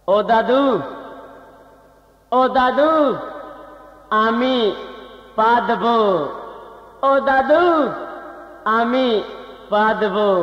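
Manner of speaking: put-on Bengali accent in Hindi. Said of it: "बंगाली बाबू बोलता हूँ अपना भाषा में".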